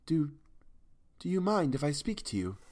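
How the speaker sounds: shy, hesitant woman's plea, a stumble at the start; timid. A narrator's reading. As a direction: ask nervously, half afraid to speak. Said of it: "she asked timidly".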